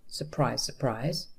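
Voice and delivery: low, soft, dry remark; understated irony under the narration; sarcastic aside. A narrator's reading. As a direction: drop it in quietly as no surprise.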